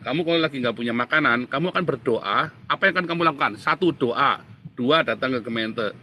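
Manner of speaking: normal talking voice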